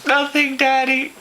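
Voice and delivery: very high hammed-up whimper; mock tearful kid. Kid answering dad at dinner, mock crying. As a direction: wail it through fake sobs.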